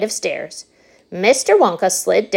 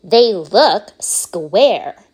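put-on bratty girl; 5 dB louder, much slower than usual. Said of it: "said Veruca Salt".

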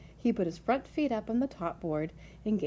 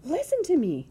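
high, loud, desperate appeal; urgent cry; 9 semitones higher, 6 dB louder than usual. A narrator's reading. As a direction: cry out to be heard. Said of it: "cried Wilbur".

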